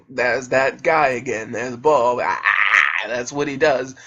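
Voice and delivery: very low, put-on English accent. [name] commenting on that guy again, low mock English accent. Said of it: "why are you talking in that accent?".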